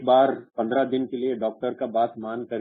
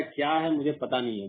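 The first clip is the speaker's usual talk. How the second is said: ordinary talk, nothing marked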